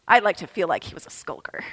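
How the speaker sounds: fast, hushed confiding whisper; softly whispered joke to the congregation; whispered aside. Real, not acted. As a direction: let them in on a secret. Playful aside.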